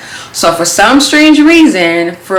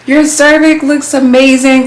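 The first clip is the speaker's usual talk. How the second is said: high, gushing doctor voice; imitating a bubbly, delighted doctor; bubbly, cheerful; 8 semitones higher, slower than usual. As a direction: gush it high, stretching out the praise. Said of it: "she's being her bubbly self, she's cheerful".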